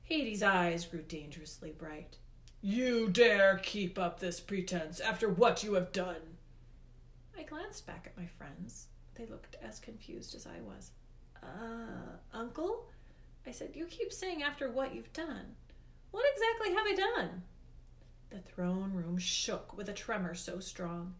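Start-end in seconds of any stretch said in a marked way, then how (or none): 2.6-6.4 loud, commanding angry god; Hades, indignant. A narrator's reading. Hades confronts the boy over his pretense, indignant. Voices Hades, dangerously angered. 11.4-17.4 puzzled. A narrator's reading. Puzzled boy questions angry Hades.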